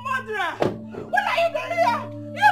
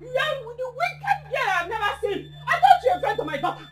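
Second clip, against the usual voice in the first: weeping curses. Acted. Grieving mother curses her dead daughter's friend, sobbing. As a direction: sob and shout, breaking down between words.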